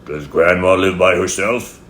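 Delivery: wolf's courteous, emphatic enquiry; polite wolf. A narrator's reading. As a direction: ask politely as the big bad wolf. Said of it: "politely asked".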